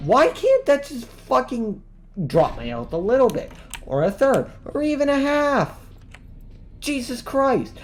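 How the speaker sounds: high, loud furious rant. Real, not acted. Gamer raging at an instant death, high loud fury. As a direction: rage at the screen, voice climbing high.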